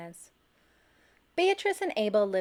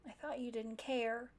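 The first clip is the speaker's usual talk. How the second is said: hurt; 2 semitones higher, 10 dB quieter than usual. A narrator's reading. Hurt friend after rescue.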